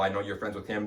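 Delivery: usual speaking voice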